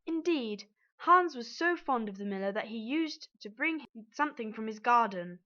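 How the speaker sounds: usual speaking voice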